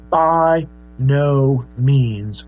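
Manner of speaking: slow, stretched, heavy emphasis on every word; a drawn-out, forceful "By no means"; emphatic. Shown as a demonstration. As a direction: lean hard into "By no means". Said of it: "It's an extremely emphatic statement".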